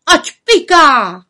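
high, loud, slow cry; shouting witches. A narrator's reading. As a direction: call it out gleefully. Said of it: "Tu zvolali větrnice".

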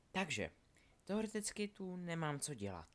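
put-on soft, high-pitched girl's character voice; Delphi, tentative and confiding. Delphi confides in Scorpius on the stairs, tentative. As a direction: speak softly and lightly, up in pitch.

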